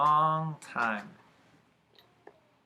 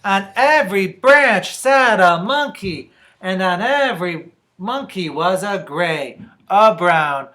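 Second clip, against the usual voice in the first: loud reveal, drawn out at the end; dramatic story narration; 6 semitones higher, 13 dB louder, slower than usual. A narrator's reading. Reader reveals monkeys to children, dramatic narration. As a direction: list each colour with weight, slowing to the last.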